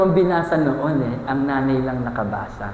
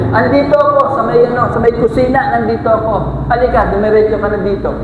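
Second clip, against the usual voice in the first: acted mother calling from the kitchen, raised voice; calling back; 6 semitones higher, 5 dB louder, faster than usual. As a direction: holler back to your child. (pasigaw na sagot ng nanay)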